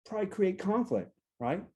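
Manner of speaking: normal talking voice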